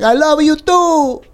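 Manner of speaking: high, drawn-out yell in a put-on Spanish accent; stretched, high-pitched Spanish-accent holler; mock Spanish accent. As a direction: mimic a politician yelling in a Spanish accent. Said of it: "She yells it, like, in a Spanish accent".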